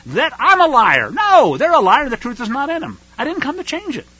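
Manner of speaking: high, indignant rebuttal, starting loud; emphatic, high-pitched denial; Yeshua. Acted. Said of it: "says Yeshua".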